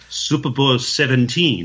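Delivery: "Super Bowl seventeen" in a mock French accent; [name]'s bad French accent on "Super Bowl seventeen". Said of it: "Or as the French would say".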